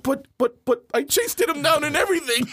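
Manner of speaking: high-pitched stammering complaint; whiny protest. Acted. Kid told to wait by cops, whiny protest. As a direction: plead your case like a let-down kid.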